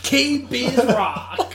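high, loud DJ voice; radio announcer voice. Pretend. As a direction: sell the station call. A mock radio promo.

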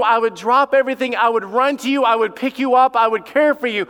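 loud, high pleading father; a father's anguished cry to his kid; pleading parent. Acted.

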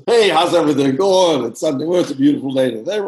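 bright, breezy bedside greeting, fast at the end; mock-cheery hospital hello; chirpy cheerful doctor. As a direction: play the upbeat doctor breezing in. Cheerful doctors, unlike the whispers.

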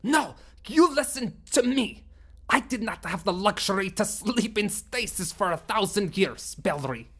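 angry alien leader. Acted. Sumblok accusing Bellry of betrayal, furious and bitter.

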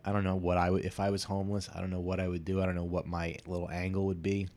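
normal talking voice

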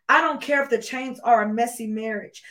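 normal talking voice

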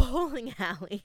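normal talking voice

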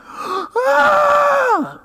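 high mock yelp of a smacked toddler; child's yelp. Pastor imitating his toddler son's yelp after a spanking. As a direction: yelp like the boy who just got spanked. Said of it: "And he's like".

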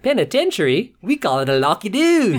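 a cheery, high mock Australian voice; mock Australian accent. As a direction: lightly play a chirpy Aussie. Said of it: "And they're like".

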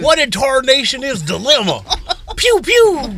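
put-on high, laughing old prospector; hammed-up Wild West old-timer. Cowboy bit.

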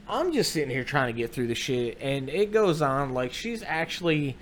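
normal talking voice

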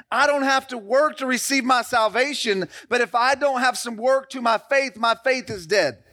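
fired-up sermon delivery; preaching. Acted. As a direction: press the point home to the congregation. Preaching builds to a peak.

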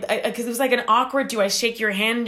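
ordinary talk

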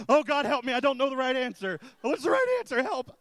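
high, loud panicked pleading; panicked husband. Retold or imitated. Husbands in the room, panicking, loud and high. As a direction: squeal for rescue from the trick question. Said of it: "all the guys in the room are like".

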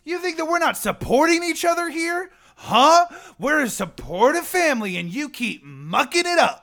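high, loud heated comeback; hammed-up family-loyalty rage; heated retort. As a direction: snap back defensively.

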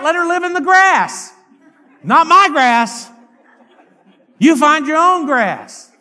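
loud, high pulpit shout; full-force preaching. Real, not acted. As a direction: hammer each phrase at the congregation.

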